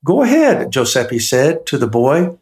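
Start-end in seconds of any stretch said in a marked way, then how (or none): 0.0-0.7 a lightly voiced jolly old baker, welcoming. A narrator's reading. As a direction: greet the boy like a proud, kindly baker. Giuseppe urges the boy.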